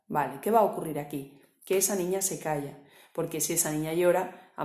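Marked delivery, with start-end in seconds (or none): none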